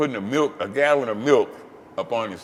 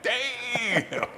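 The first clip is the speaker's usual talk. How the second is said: awed damn; 12 semitones higher than usual. Retold or imitated. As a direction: let it out in disbelief. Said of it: "I'm like".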